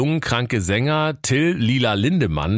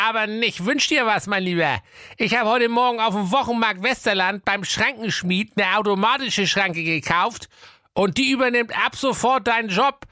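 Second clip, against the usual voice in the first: king voice; 14 semitones higher than usual. A narrator's reading.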